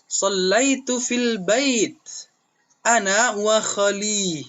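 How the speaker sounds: slow, high recited answer; teacher models the pupil's answer, slow and high. Shown as a demonstration.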